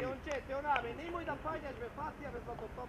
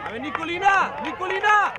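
loud shout of a name; name shouted across the field; calling out; 9 dB louder than usual. Real, not acted. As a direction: yell to get a player's attention. Coach calling a player.

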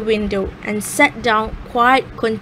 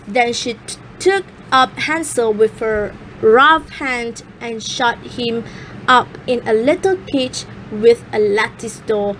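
usual speaking voice

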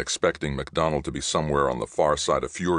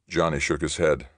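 usual speaking voice

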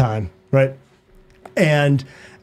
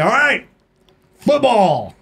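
cocky challenge; 7 semitones higher, slower than usual. Retold or imitated. Voicing his group's challenge.

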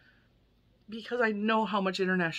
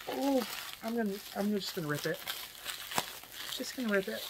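imitating jealous self; 4 dB quieter, faster than usual. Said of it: "I was jealous 'cause I was like".

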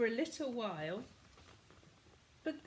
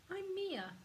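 put-on little girl; 8 semitones higher than usual. Little girl introduces herself.

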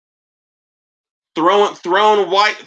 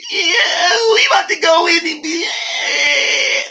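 mock hype vlogger voice; 10 semitones higher, slower than usual. Mocking a rival's mall vlog to his viewers, high squealing yell. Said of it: "why you talk like that".

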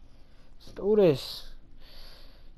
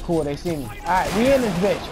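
ordinary talk, nothing marked

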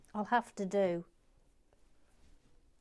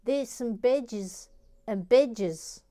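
loud, high, slow mock-Australian; put-on Australian, drawing out "bedges"; Australian accent; 3 semitones higher, 6 dB louder, slower than usual. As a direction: exclaim it in a broad Australian accent. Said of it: "badges or badges in Australian".

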